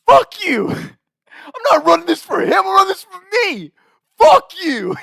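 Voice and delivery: high, loud defiant yell; yelling back. Retold or imitated. Trey yelling back at the coach, defiant yell. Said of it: "yelling back at our coach".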